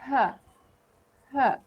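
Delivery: "Huh, huh" said short and weak, no emphasis; weak uh sound. Shown as a demonstration. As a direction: say "huh" as a light unstressed schwa. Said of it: "So we say".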